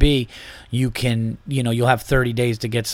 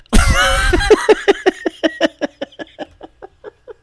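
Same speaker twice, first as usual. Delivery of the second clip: high squeaky suppressed laugh; laughter bursting through; stifled giggles. Real, not acted. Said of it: "I'm trying to keep a straight face".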